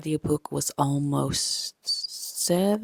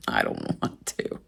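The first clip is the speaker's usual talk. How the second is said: whiny groan; 5 dB quieter than usual. Real, not acted. Whiny refusal to train.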